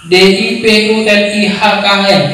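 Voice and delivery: "D I P U L I H K N" letter by letter; letters called out one by one; spelling it out. Shown as a demonstration. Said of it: "Atau saya yang salah baca".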